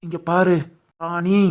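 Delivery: soft coaxing. Acted. Husband softly coaxing wife.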